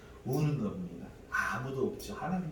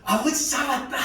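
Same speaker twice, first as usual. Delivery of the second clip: laughing, much higher and louder; joyful laughing cry; 14 semitones higher, 9 dB louder than usual. Retold or imitated. Son catching God the Father, laughing loud joy. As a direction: shout it laughing with delight. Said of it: "이런 느낌".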